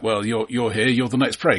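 ordinary talk

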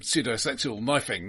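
usual speaking voice

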